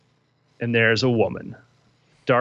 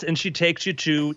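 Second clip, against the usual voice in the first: ordinary talk, nothing marked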